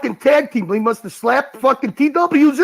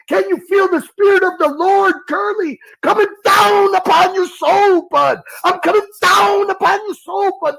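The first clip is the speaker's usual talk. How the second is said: high, drawn-out revival call; mock preacher; 5 semitones higher, slower than usual. Calling the Lord's spirit onto a rival, mock preaching. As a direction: stretch the words like a hammed-up evangelist.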